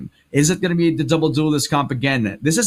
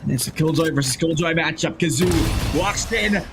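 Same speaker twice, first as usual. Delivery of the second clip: rising excited esports cast call; play-by-play call; 11 semitones higher, 4 dB louder than usual. Acted. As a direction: build the call to a shouted finish.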